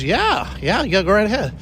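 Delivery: high, bright mock cheerful yes; a hint of a chirpy receptionist; cheerful casting lady.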